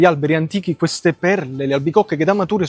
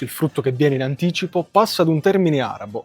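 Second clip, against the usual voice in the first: ordinary talk, nothing marked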